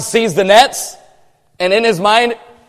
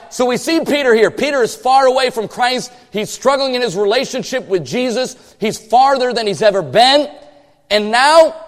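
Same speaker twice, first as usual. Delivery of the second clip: usual speaking voice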